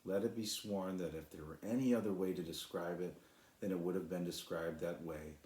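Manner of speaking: ordinary delivery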